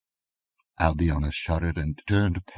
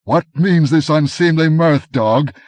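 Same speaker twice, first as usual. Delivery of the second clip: much higher, louder nervous challenge; uneasy demand; 9 semitones higher, 10 dB louder than usual. A narrator's reading. Aquilonian to the laughing headsman, loud uneasy demand. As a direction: bluster over fear. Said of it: "demanded the Aquilonian uneasily".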